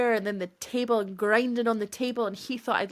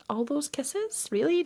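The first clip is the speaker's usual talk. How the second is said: incredulous; 6 semitones higher than usual. Retold or imitated. Incredulous at his text.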